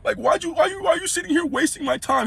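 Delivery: high, teary mock of her near tears; tearful girlfriend. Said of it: "I can feel the tears coming".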